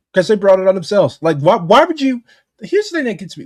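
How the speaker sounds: ordinary delivery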